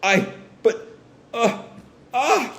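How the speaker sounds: halting stammer rising to a high cry; panicked stammer. Retold or imitated. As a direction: falter in acted shock. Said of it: "Jesse's sort of in a panic".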